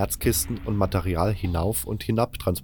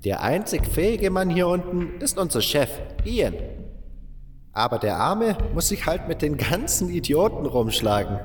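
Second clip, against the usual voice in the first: much higher old-man voice, mocking; old miner gossiping; 8 semitones higher than usual. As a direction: sneer at the misfits, praise only the boss. Old butler gossips about mine.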